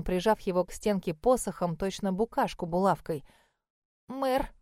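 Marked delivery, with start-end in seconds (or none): 4.1-4.5 babbling. A narrator's reading. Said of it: "пролепетал он".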